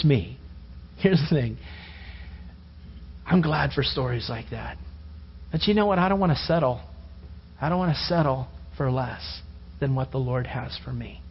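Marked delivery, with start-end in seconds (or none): none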